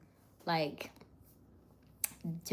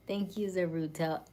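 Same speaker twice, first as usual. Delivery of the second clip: ordinary delivery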